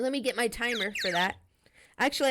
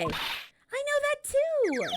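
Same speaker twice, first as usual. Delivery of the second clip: exasperated; much slower than usual. Acted. Nick exasperated.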